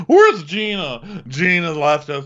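put-on slow, high drunken wail; drunk Emily. Drunk woman looking for her friend, slow high wail. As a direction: draw out the name like a lost drunk.

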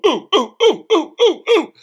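loud, high hooting, rising each time; high hooting ooh. Retold or imitated. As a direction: hoot like a party guy at a bar. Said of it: "[name] is doing his ooh".

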